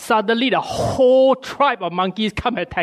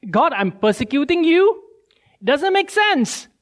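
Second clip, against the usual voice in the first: much higher, incredulous question; can't believe what he hears; Paul stunned at hearing God's voice; baffled Paul; 8 semitones higher than usual. Acted. Paul's baffled reply voiced.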